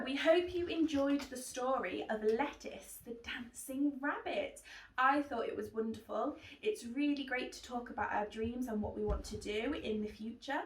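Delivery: usual speaking voice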